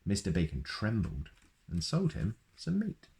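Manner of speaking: usual speaking voice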